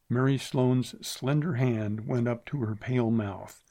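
usual speaking voice

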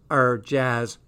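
ordinary delivery

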